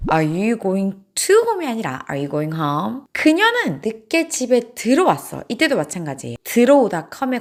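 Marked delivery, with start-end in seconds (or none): none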